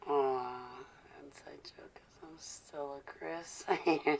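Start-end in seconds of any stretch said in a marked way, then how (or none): 1.2-3.6 whispering. Real, not acted. Aside to a viewer.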